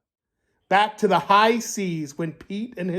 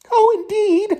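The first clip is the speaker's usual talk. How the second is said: high, eager little rascal voice; a rascal answers the pirate captain, high and eager; chirpy rascal; 4 dB louder than usual. Acted. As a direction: pipe up like a proud rascal.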